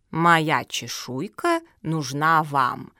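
put-on slow, deliberate old tortoise. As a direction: spell it out slowly, word by word. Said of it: "медленно сказала Зоя".

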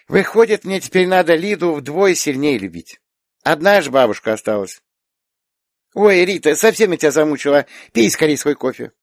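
put-on small girl reasoning, then bossily caring; chattering little girl. A narrator's reading. Little girl hurries aunt to drink coffee, earnest.

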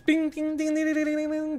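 high-pitched tinkling imitation of glass; ding ding like a bouncing jar; high ringing ding sounds.

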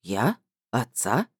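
low, halting, baffled questions; lightly voiced man, dumbfounded; Vladimir, taken aback. A narrator's reading. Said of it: "вконец опешил Владимир".

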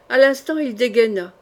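ordinary delivery, nothing marked

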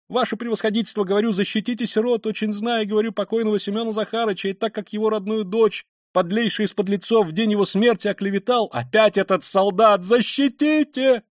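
high indignant plea building to a shout; crying out. A narrator's reading. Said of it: "закричала она чиновнику". (возмущённо, срываясь на высокий крик)

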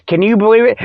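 ordinary talk, nothing marked

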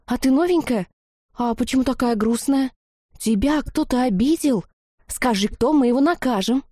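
put-on high, girlish concerned chatter; shower the newcomer with questions; eager questioning. A narrator's reading. Girls fussing over a sad newcomer, eager questioning. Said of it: "засыпали вопросами".

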